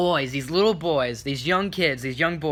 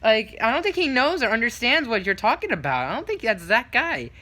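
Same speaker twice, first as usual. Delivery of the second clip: higher-pitched correcting mom voice; imitated mom; 8 semitones higher than usual. Mom correcting her son about Spider-Man, imitated. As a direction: gently set the kid straight, voice raised in pitch. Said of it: "the mom's like".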